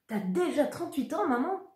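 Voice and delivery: high, mock-astonished teasing; teasing brother. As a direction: needle her with fake shock. Brother teases mother about age.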